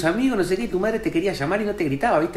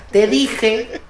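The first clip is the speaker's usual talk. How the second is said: mock told-you-so cry; mother's scolding yell; 2 semitones higher than usual. Vindicated mother to her hurt kid, mild scolding yell. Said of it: "¿qué le grita?".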